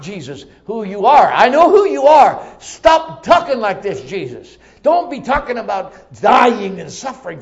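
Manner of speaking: alarmed disciple telling him off; acted indignant rebuke. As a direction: scold a friend at full voice. Peter acted rebuking Jesus.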